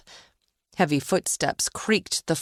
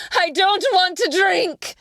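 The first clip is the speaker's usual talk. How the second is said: high, loud furious scream; 14 semitones higher, 5 dB louder than usual. A narrator's reading. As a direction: scream it in panic. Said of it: "she screamed".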